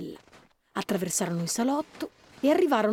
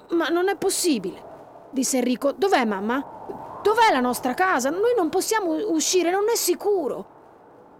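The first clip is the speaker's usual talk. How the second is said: higher, worried pleading; a frightened child losing his bearings; boy afraid, voice lifting in worry; scared boy; 7 semitones higher, 4 dB louder than usual. Acted. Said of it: "Enrico aveva paura".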